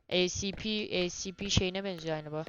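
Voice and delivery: normal talking voice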